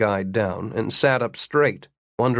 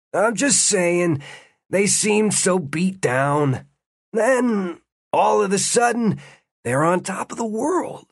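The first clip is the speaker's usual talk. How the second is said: guard gets worked up, higher and higher; pressing on, rising; 6 semitones higher than usual. A narrator's reading. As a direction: push on, climbing in pitch. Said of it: "The guard pressed on".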